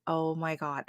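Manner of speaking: near-whispered retold aside; mortified whisper. Owner dragging the dog away, mortified whisper. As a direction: drop to a hushed mutter of dismay.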